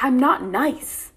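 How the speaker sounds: "I'm not nice" drawn out, loud and high; emphatic, not yelled. Retold or imitated. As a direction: hit every word of "I'm not nice" slowly, short of a yell. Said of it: "And I didn't yell".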